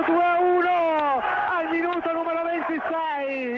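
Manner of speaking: excited goal call; goal shout. Real, not acted. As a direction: roar the goal.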